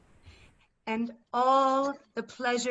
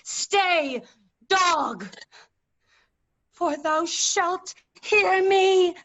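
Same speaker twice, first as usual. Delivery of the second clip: high, loud command slowing at the end; bellowed curse; furious shout; 6 semitones higher, 4 dB louder, slower than usual. Acted. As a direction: stop him dead with it. Margaret halts Richard to curse him.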